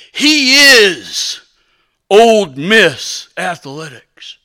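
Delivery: slow, weighty proclamation; hammed-up solemn pronouncement; grand declaration. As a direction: declare it with big dramatic pauses. Dramatic declaration of fans' view.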